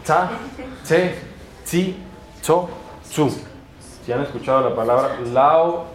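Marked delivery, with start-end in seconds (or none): 0.0-3.3 "tsa, tse, tsi, tso, tsu" with a crisp ts, high; a crisp ts on "tsa, tse, tsi, tso, tsu", one by one; Esperanto C syllables. Shown as a demonstration. Teacher drilling the Esperanto C syllables. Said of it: "El burro, no".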